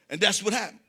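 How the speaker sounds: normal talking voice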